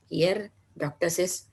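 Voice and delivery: ordinary talk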